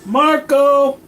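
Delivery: high, loud searching shout; calling out. Pretend. Players locating each other underground, high loud call. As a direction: holler it far and high. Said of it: "unless they're constantly calling out".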